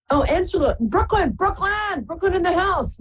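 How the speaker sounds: high, delighted calls of Brooklyn; excited greeting. Real, not acted. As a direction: sing out the hometown with joy. Caller from her hometown Brooklyn.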